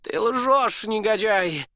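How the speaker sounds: high, loud furious accusation; duke shouting. Acted. Duke to Jacob, a furious high shout. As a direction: hurl it at the dwarf. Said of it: "закричал герцог".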